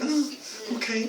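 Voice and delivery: small, high, soft imitation of resignation; reluctant meek acceptance. Poor teacher told of a class visitor, reluctant meek acceptance. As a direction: meekly give in, very softly. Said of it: "the poor woman going".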